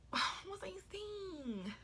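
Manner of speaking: high breathy whisper. Real, not acted. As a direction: whisper it to yourself. Trying to recall, whispered.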